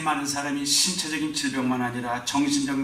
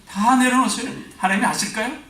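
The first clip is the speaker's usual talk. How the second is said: preaching; 8 semitones higher, 5 dB louder than usual. Real, not acted. Preaching emphasis.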